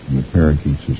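ordinary delivery, nothing marked

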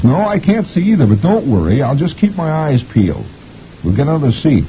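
high wifely reassurance; determined wife. A narrator's reading. Wife promises husband another seat, high and brisk. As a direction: answer brightly in a high voice. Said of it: "And she says".

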